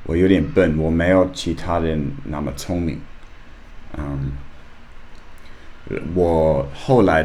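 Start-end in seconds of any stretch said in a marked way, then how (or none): none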